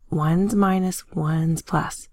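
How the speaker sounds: normal talking voice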